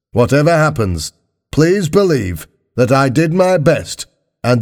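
high-pitched politician addressing the nation; formal political broadcast, pitched high; Prime Minister's address. Acted.

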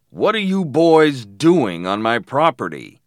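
loud, menacing challenge to trespassers; angry shout. A narrator's reading. Man confronting boys on his property, angry shout. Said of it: "he shouted".

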